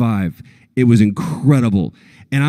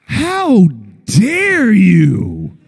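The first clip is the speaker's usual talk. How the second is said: slow, higher, hammed-up how dare you; mock offended; 5 semitones higher, much slower than usual. Actor pretending offense at the horse question, mock outrage. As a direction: play fake outrage, drawn out. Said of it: "I said".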